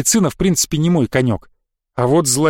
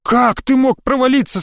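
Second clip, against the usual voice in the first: higher-pitched, strained question; voice gone weak; 8 semitones higher than usual. A narrator's reading. Boy questioning fallen friend, voice failing. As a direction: ask it with a failing voice. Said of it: "спросил я севшим голосом".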